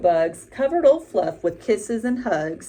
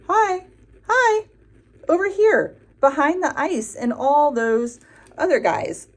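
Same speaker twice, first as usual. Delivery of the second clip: Pluto voice; 4 semitones higher, 3 dB louder than usual. A narrator's reading. Pluto speaks in first person.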